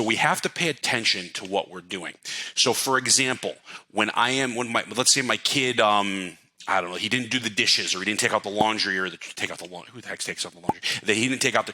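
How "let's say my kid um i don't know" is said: ordinary talk, nothing marked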